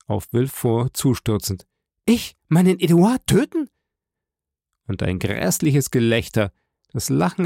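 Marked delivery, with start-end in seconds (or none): 2.0-3.7 high, outraged mother's voice; impassioned cry. A narrator's reading. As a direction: cry out in horrified disbelief. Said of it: "rief in höchster Leidenschaft diese Mutter".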